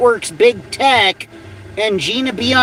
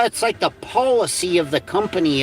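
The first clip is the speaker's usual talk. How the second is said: normal talking voice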